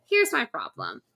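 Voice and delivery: ordinary delivery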